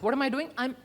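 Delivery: usual speaking voice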